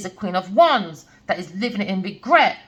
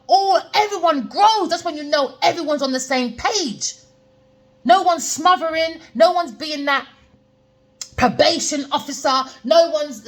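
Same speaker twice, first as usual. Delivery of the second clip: passionate; 7 semitones higher, 3 dB louder than usual. Real, not acted. Passionate rant about energy.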